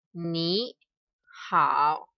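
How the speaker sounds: ordinary talk, nothing marked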